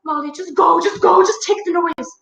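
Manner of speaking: high, desperate refusal; overwhelmed, wailing dismissal; exasperated fairy queen. Acted. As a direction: beg them to leave, at wit's end. Queen can't stand the noise.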